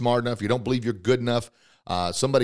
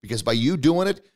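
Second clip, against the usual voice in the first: usual speaking voice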